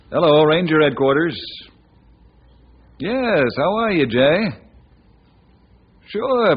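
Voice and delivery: loud, cheerful telephone talk; phone call. Acted. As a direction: speak up clearly for the caller on the line.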